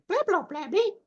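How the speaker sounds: squeaky high B-babble; Bag puppet voice. Shown as a demonstration. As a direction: talk in high-pitched puppet gibberish. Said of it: "I just used the B".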